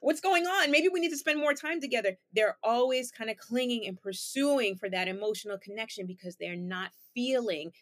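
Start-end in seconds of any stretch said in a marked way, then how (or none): 0.0-2.2 higher, anxiously pleading partner; anxious clingy partner. Retold or imitated. Clingy partner complains after being left, anxious and pleading. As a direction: panic as your partner walks away. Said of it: "the partner starts complaining".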